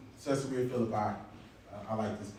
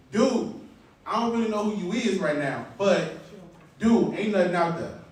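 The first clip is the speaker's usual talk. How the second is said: louder, higher mock-exasperated Peter; retold protest with a raised, incredulous "dude"; 6 semitones higher, 4 dB louder than usual. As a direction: push back like a fed-up fisherman, acted out. Acts Peter protesting to Jesus.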